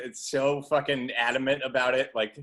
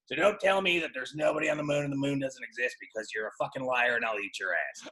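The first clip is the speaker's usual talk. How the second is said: normal talking voice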